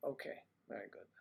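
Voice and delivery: quick whispered okay; whispered aside. Real, not acted. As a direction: mutter it off fast under breath.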